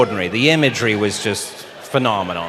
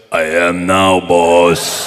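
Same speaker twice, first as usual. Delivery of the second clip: deep, loud giant's rumble; low booming giant voice; Andre the Giant impression; 5 semitones lower, 8 dB louder, much slower than usual. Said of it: "doing your Andre the Giant impression".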